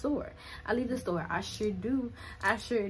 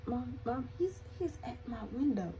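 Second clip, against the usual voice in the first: softer, urgent hushed plea to mom; hushed and scared; 4 semitones higher, 6 dB quieter than usual. Retold or imitated. Scared call with man at window.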